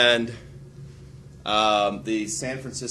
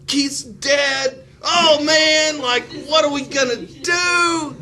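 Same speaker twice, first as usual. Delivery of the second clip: high, panicky mock wail; mock freaking out; 7 dB louder, slower than usual. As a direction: play a scared citizen fearing the draft. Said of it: "you're like".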